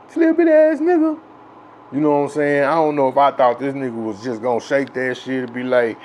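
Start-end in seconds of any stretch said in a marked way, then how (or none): 0.1-1.2 high, loud incredulous bully; mock outrage. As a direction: play the big guy stunned that a kid hit him. Big bully reacts to being hit.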